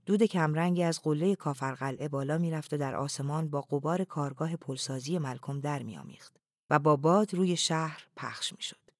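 plain reading voice

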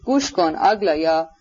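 usual speaking voice

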